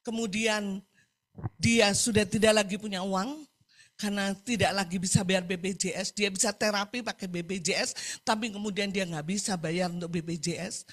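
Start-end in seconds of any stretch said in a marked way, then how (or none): none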